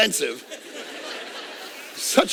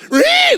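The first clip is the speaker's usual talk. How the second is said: high, loud hyped squeal; jacked-up yell; 8 dB louder than usual. Shown as a demonstration. Pastor showing his jacked-up preaching, a high loud squeal. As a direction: let out a fired-up preacher's yell. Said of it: "I preach in this kinda jacked up".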